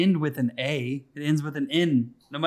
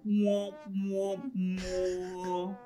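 sad trombone mwah mwah; 4 semitones higher, 7 dB quieter, much slower than usual. Shown as a demonstration. Cohost asks for a trombone, so he hums a sad trombone sound. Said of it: "Can we get some, like, a trombone?".

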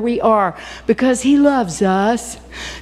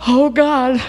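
voice breaking; 3 semitones higher than usual. Real, not acted. Preacher breaking down mid-sermon.

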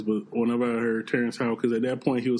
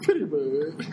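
laughing parrot voice; 3 semitones higher, slower than usual. Retold or imitated. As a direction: parrot squawk while laughing. Parrot line through laughter.

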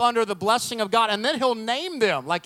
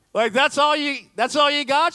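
higher, indignant mock complaint; incredulous protest; 7 semitones higher, 4 dB louder than usual. Husband fishing for more praise from wife, incredulous. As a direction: push it up high in comic disbelief.

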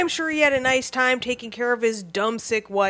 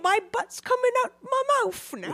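high, softer mock cockney wail; a dying Londoner in a silly high cockney; 11 semitones higher, 5 dB quieter, faster than usual. Adding to the friends' British sickness bit, high mock cockney wail.

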